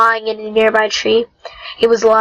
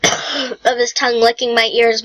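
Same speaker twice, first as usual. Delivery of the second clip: plain reading voice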